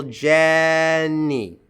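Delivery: Jenny with JEN held long; slow demo of Jenny, stressed at the front; first-syllable stress. Shown as a demonstration. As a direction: stretch the first syllable of Jenny, then drop to NY. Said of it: "stress on the first syllable".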